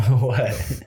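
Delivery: laughing. Real, not acted. Laughing at friend's math slip.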